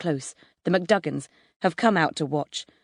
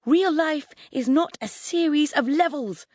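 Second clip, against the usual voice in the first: much higher, furious exasperation; flipping out; 10 semitones higher than usual. A narrator's reading. Mum lecturing gamer boys, flipping out. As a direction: rant at the end of your tether. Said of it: "Mum flips".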